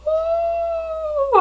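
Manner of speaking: a high crying wail; tearful sob. Real, not acted. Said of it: "Why am I crying?".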